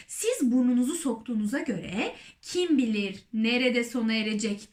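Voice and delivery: plain reading voice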